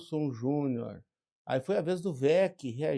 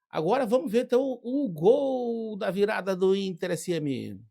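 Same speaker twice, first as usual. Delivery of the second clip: excited; 6 semitones higher, 6 dB louder than usual. Real, not acted. Build-up to the winning goal.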